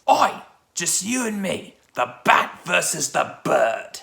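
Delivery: lightly imitated Cockney Penguin; slow Cockney villain taunt; Cockney accent. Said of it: "one of the best Cockney accents".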